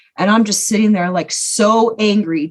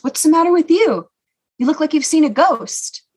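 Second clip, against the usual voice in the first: higher-pitched quoted uncle; lightly acted, puzzled questioning; uncle's puzzled question; 7 semitones higher than usual. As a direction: mimic a relative asking what's wrong. Uncle's remark acted in story.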